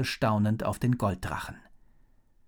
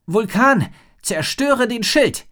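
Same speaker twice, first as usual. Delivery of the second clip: very high, loud, slow battle cry; girl shouting at her dragon in battle; shouting command; 11 semitones higher, 8 dB louder, slower than usual. A narrator's reading. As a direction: hurl the order at the dragon. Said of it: "rief Rory".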